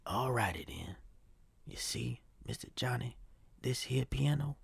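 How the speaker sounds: put-on hushed, breathy secret to a boy; secret whisper. Old pianist whispers a secret to young Johnny. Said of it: "I'm gonna let you on a little secret".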